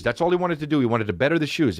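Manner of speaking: usual speaking voice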